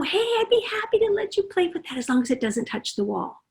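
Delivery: high and soft, kindly; soft friendly limit. Shown as a demonstration. Parent warning the child about the wall, soft and friendly. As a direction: set the limit gently, like an invitation. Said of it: "you're soft and friendly and nice".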